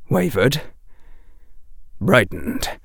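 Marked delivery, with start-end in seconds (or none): none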